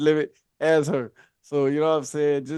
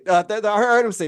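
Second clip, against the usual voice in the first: ordinary talk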